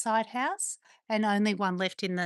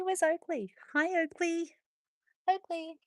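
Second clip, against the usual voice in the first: much higher sing-song pet voice; dog-talk voice; 8 semitones higher than usual. Real, not acted. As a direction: call the dog sweetly and high. Looking for her dog.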